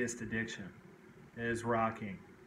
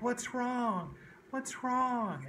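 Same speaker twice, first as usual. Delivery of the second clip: put-on much higher girl's voice asking what's wrong; concerned little girl; 11 semitones higher, much slower than usual. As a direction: ask like a worried preschool girl. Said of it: "she's like".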